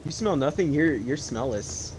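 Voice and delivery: ordinary talk, nothing marked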